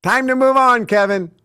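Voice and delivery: airy, unbothered shrug of a voice; breezy dismissal. Retold or imitated. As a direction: toss it off lightly. Lily dismissing her lost castle.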